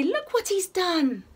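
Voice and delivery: hammed-up parental outrage; furious parents. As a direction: play the shocked, cross parents for kids. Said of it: "Mum and Dad are furious".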